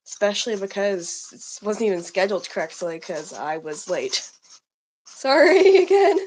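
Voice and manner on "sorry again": laughing through the apology. Real, not acted. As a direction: giggle out the sorry.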